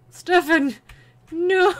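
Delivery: loud, high panicked yell; alarmed shout. Real, not acted. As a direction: shout his name in alarm. Player's character in danger.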